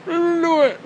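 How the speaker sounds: high, goofy enthusiastic voice; imitating his friend. Friend raving about the angels, high goofy voice. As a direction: ham up the starstruck friend. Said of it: "D L T is like".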